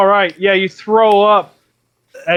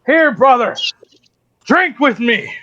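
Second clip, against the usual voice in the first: higher-pitched hearty invitation; comradely bear; 6 semitones higher, slower than usual. Acted. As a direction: bear urging a new brother to drink. Bear offers ale in game.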